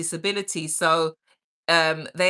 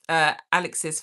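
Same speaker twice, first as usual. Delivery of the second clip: normal talking voice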